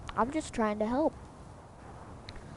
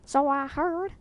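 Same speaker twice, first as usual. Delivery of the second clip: mock country voice; 3 semitones higher, 7 dB louder, slower than usual. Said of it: "Not even country".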